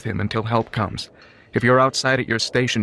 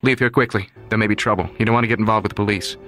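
quick, pressing, slightly higher; urgent warning; 4 semitones higher, faster than usual. Acted. Son warning the baker's helper to leave, urgent. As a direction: hurry him away before trouble comes. Warns young man as car approaches.

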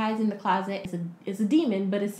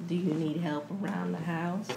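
creepy delivery guy; 4 semitones lower, 6 dB quieter than usual. Retold or imitated. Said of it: "This creepy dude".

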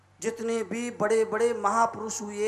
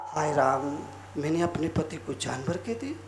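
low, soft self-reproach; hushed acted dismay; remorseful; 8 semitones lower, 6 dB quieter than usual. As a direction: murmur as the regretful wife. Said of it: "उसे पश्चाताप हुआ".

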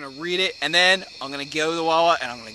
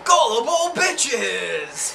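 slow, high, loud taunt with a laugh; mocking shout; 8 semitones higher, 5 dB louder, much slower than usual. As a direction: gloat it at the viewers.

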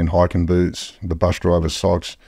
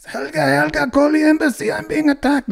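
much higher, put-on Swedish accent calling for help; fake Swedish plea. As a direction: call out to the backpacker Helga for the embassy. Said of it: "these coppers start panicking".

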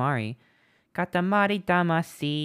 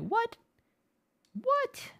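two squeaked "What?" much higher; shocked; 3 dB quieter than usual. Retold or imitated. Streamer misreads chat that Lychee could never win, shocked squeal. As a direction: leap up in disbelief. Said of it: "I'm like".

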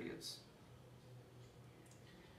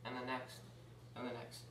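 fading repetition; 3 semitones lower, 8 dB quieter than usual. Retold or imitated. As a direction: lower and softer, dying away. Endless chase trailing away, repeated.

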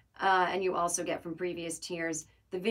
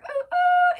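two very high, loud whoops; celebratory whoops; 12 dB louder than usual. Real, not acted. Host cheering the arrival of the Patreon, celebratory whoops. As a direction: cheer it with piercingly high hoots.

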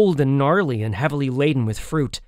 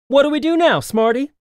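put-on high, pointed girl's challenge; accusing; 10 semitones higher, 4 dB louder than usual. A narrator's reading. Girl cornered at the cliff, accusing the boy. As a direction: throw the question at him in a high voice. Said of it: "The girl turned to me accusingly".

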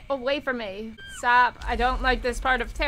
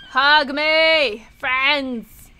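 loud, eager call; playful shout; 2 semitones higher, 7 dB louder, much slower than usual. Pretend. Gamer gorilla chasing humans for hugs, playful loud call. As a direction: holler it out to the crowd.

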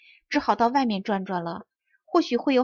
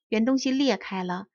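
plain reading voice

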